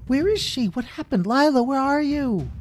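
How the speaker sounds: very high, loud searching call; frantic calling. Acted. Bramble calling for lost Lila, high frantic call. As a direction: call out for her anxiously.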